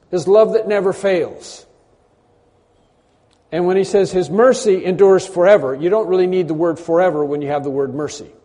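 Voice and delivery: usual speaking voice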